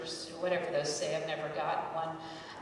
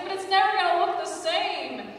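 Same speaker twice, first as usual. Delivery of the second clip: much higher, louder whining complaint; whiny wail; 10 semitones higher, 7 dB louder than usual. Retold or imitated. To her mom, wailing in mock despair. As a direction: whine it like a hurt teen. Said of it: "I was like".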